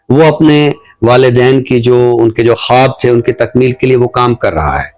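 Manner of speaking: ordinary talk, nothing marked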